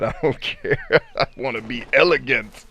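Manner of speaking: amused quote broken by laughter; laughing quote. Retold or imitated. Laughs while quoting a viewer's answer.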